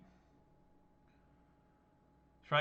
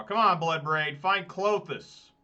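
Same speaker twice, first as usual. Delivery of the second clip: louder, higher plea to the cards; urging; 4 semitones higher, 8 dB louder, slower than usual. Real, not acted. Player rooting for a good draw, urging. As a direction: call out hopefully for the card you need.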